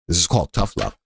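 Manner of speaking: lightly hammed-up stern lecturer; mock tough-love voice. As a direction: drop into a gruff tough-love parent. Repeats line, second take voiced.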